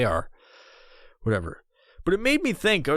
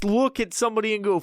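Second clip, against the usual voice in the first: ordinary delivery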